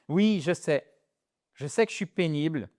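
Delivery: ordinary talk, nothing marked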